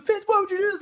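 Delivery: high voiced sister scolding; mimicking sister. Sister scolds her brother, high whiny mimic. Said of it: "She goes".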